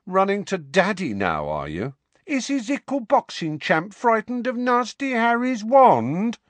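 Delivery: high, sing-song taunting; mocking baby talk. A narrator's reading. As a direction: coo it like mocking a toddler. Harry mocks Dudley in baby talk.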